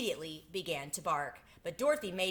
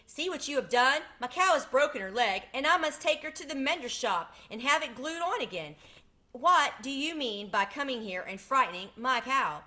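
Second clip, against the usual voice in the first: higher, louder scolding; angry milkmaid; 6 semitones higher, 4 dB louder than usual. Acted. Milkmaid scolding Dorothy over her broken cow, angry and louder. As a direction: scold the clumsy strangers hotly. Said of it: "cried the milkmaid angrily".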